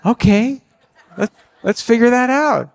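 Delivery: high, loud, cheery imitation of a wife; mock-bright high-pitched agreement; agreeable wife. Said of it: "And she says".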